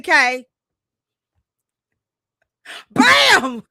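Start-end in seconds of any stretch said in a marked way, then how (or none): 2.9-3.6 loud, high burst of excitement; excited bam. Real, not acted. Host showing viewers the Cleveland snow, loud excited shout. Reveals snowy Cleveland outside.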